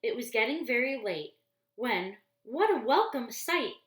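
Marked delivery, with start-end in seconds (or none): 2.4-3.8 bright, lifted exclamation of relief; relieved delight. A narrator's reading. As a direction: sound glad to finally see shelter. Relief at seeing the inn.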